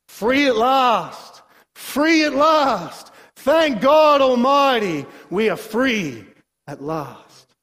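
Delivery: slow, high, ringing preacher's proclamation; stretched-out jubilant oratory; preaching cry. Acted. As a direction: proclaim it like a civil rights sermon. Echoing the famous freedom speech.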